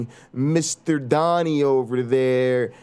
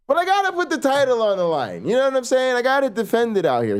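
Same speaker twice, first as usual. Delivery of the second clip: hyped up; 7 semitones higher, faster than usual. Acted. Back to title defense hype.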